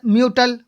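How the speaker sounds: "म्यूटल" with hard T in place of CH; wrong pronunciation. Shown as a demonstration. Teacher giving another word to avoid misreading, myu-tal mispronunciation. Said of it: "म्यूटल नहीं बोलेंगे".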